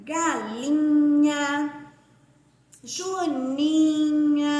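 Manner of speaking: galinha and Joaninha stretched very slowly, nh held; teacher stretches nh words for pupils; slow syllable demo. Shown as a demonstration. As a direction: draw out each syllable for the class. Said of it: "O N H".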